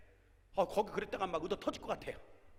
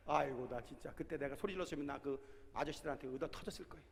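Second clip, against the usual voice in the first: ordinary talk, nothing marked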